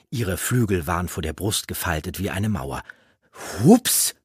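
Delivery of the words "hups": high, loud, annoyed mimicking oops; irked, rising mock oops; grumbled echo. A narrator's reading. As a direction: throw the oops back crossly. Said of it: "murrte Eragon".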